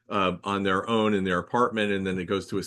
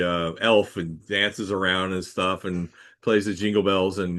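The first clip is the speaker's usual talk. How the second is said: usual speaking voice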